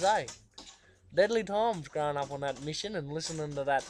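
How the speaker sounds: ordinary delivery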